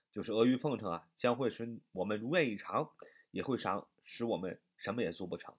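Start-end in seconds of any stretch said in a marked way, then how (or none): none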